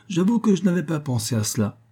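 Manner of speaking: put-on high-pitched, amused old lady; teasing old mother. A narrator's reading. Mother teasing her son, playful.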